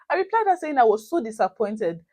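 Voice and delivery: usual speaking voice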